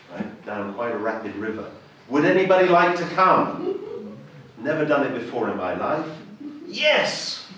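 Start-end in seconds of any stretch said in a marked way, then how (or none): 2.1-3.7 louder, higher call to a group; calling out an invitation. Retold or imitated. Volunteer announces trip to group. 6.7-7.4 high-pitched eager outburst; eager yes. Retold or imitated. Acts out jumping at the offer.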